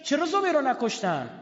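high, loud impassioned sermon telling; preaching at full force. Acted. Preacher crying out the tale to mourners, impassioned. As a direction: cry out the story to the mourners. Preacher recounting the battle, raised.

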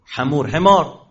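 «خمور» with throaty kh, then «حمار» with plain h. Shown as a demonstration. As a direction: set «خمور», khamur, against «حمار», hemar. («خمور» با خ، بعد «حمار» با ح)

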